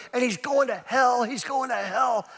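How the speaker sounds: high-pitched mock wail; imitated sobbing mother. Mother fears for her son's soul, mock sobbing. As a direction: imitate a mom in tearful distress. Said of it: "She's just sobbing".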